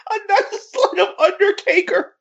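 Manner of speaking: giggling through a quote; reading it out mid-laugh; laughing while reading. Retold or imitated. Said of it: "Squire said".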